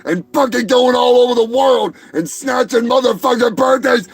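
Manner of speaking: high-pitched, strained comic yelling; exasperated rant. Acted. Birthday boy denied his cake by Seagal, exasperated rant. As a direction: build into a relentless frustrated shouting tirade.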